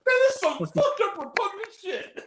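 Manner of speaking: high, hammed-up disgust; theatrical movie outrage; mock outrage. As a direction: act appalled, pitched high, close to laughing. Acting out film dialogue.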